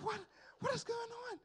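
voix très aiguë, incrédule; lance-le comme un cri de stupeur; shocked disbelief. Retold or imitated. Panicked realization while walking alone.

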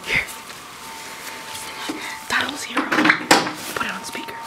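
whispering. Real, not acted. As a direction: keep it hushed, hiding. Hiding during prank.

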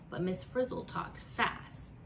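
ordinary delivery, nothing marked

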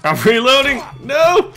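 loud, high laughing yell; frantic shout. Real, not acted. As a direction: yell in panic. Frantic under attack.

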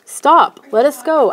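high, loud cry for release; shouted. A narrator's reading. Kid carried off by gnomes demands release, shouting. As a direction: shout at captors to halt. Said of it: "I shouted".

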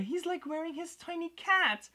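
high-pitched gushing over something tiny; cooing excitement. Real, not acted. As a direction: coo over the tiny figure. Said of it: "I'm sorry".

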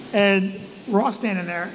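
usual speaking voice

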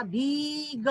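भीग with a stretched ee, then ग; long vowel. Shown as a demonstration. Teacher modelling the joined word, drawn-out भीग. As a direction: hold the ee before the ग in भीग. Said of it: "भीग शब्द बन गया था".

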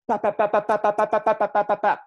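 quick high popping "pop, pop, pop"; pointillist dabbing sounds. Retold or imitated. Son showing how van Gogh painted with pop sounds. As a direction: dab out each "pop" like a brush tap. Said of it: "why he painted like this".

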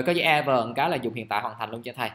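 ordinary talk

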